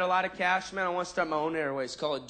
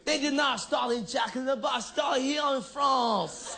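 indignant French retort; 6 semitones higher, slower than usual. Retold or imitated. Offended French waiter correcting the comic, hammed-up shout. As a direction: huff back like an offended waiter.

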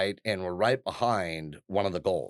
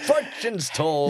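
slow, high mystic seer voice; fortune teller; 7 semitones higher, 4 dB louder, slower than usual. Pretend. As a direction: drawl it like a carnival psychic. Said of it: "like, fortune teller".

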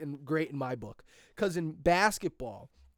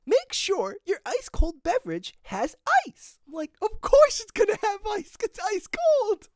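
mock trainer voice; 5 dB louder than usual. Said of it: "He was like".

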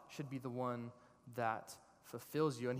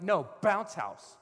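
slow, loud, higher mock refusal; stubborn insistence; 5 semitones higher, 9 dB louder, slower than usual. You arguing back at God, stubborn. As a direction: dig in on BOUNCE HOUSE.

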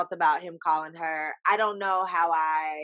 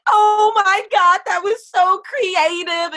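much higher, louder, slow gushing voice; gushing fan; 11 semitones higher, 8 dB louder, slower than usual. Retold or imitated. Imitating people who love the proposal, gushing. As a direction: swoon over it like an impressed fan. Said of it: "people are gonna be like".

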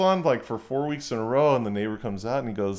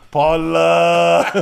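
slow, loud drawn-out call; 8 dB louder than usual. Retold or imitated. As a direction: stretch the name into a yell. Drunk neighbor yelling for wife.

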